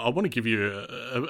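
ordinary talk, nothing marked

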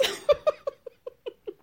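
high, breathy burst of laughter. Real, not acted. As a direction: laugh hard at the story. Laughing after quote.

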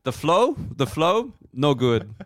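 put-on high, questioning German-accented broken English; bad Dutch-German accent; mock German accent. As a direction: play a fussy comic German. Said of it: "the Dutch accent as a German guy".

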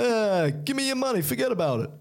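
high, exaggerated New York mugger; hammed-up New York stick-up demand; mock New York accent. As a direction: push the cartoon New York accent right up in pitch. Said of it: "By the accent".

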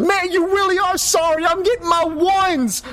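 high mocking villain voice; NPC taunt. GM voicing the brute mocking the fallen player. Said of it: "and he goes".

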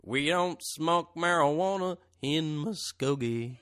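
put-on audition line in a Southern accent. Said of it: "do it in a Southern accent".

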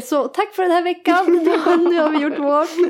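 high-pitched mock farewell through giggles; laughing sign-off. Co-host pretending to end the podcast, giggly sign-off. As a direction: wrap up jokingly, breaking into laughter.

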